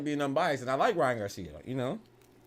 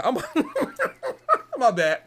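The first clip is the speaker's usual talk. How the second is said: normal talking voice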